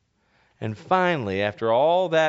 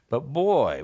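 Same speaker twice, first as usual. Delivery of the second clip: usual speaking voice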